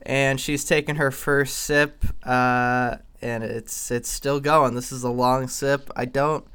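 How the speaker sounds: playful play-by-play announcer voice; sports commentator. Acted. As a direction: call it like a live sports broadcast. Mock sports commentary on the drinking.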